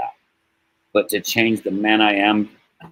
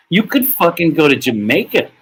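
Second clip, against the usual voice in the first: higher voice breaking into real laughter; laughing punchline; 9 semitones higher, 4 dB louder than usual. Real, not acted. Storyteller joking about grinding at work, breaking into laughter. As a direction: deliver the punchline high, tipping into a laugh.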